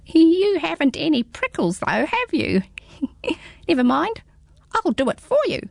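high, small squeaky creature; a tiny curious hedgehog peering up; high little hedgehog, puzzled. Acted. Hedgehog teasing.

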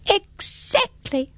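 very high, slow little hedgehog, curious; squeaky small creature, teasing. Acted. Hedgehog agrees.

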